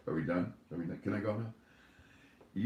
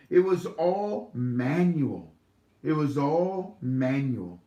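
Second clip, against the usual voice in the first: higher-pitched, halting read-aloud; report read aloud; 5 semitones higher than usual. A narrator's reading. Host reading a contact's stock exchange report, read-aloud style. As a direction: read it out emphatically, slowing toward the end.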